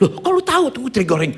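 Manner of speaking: high, loud astonished question; startled. Retold or imitated. Mother amazed he knows it is fried anchovies, acted startled question. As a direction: act out being caught by surprise. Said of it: "Dia kaget".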